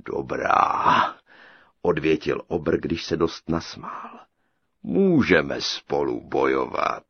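low, slow, loud thundering giant; booming giant. A narrator's reading. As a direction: boom it out like a huge ogre. Giant voiced. (hřmotný obr, hluboce a pomalu)

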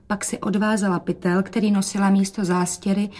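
ordinary delivery, nothing marked

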